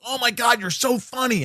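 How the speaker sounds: high-pitched, gushing imitation; star-struck squeal of praise, imitated; gushing fan. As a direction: mimic a thrilled admirer. Retelling a star's gushing greeting.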